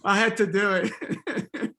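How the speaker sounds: laughing through the words. Real, not acted. Teacher laughing at his own Ohio joke. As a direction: giggle out the confession.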